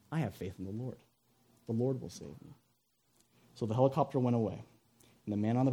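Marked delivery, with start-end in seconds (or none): 0.1-2.5 very soft, low voice; serene faith. Acted. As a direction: calmly assure them, almost under your breath. Stranded man's serene faith, third time.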